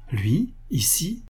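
startled, slow near-whisper; pained shock. A narrator's reading. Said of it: "en tressaillant sans pouvoir cacher un sentiment douloureux".

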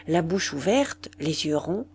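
usual speaking voice

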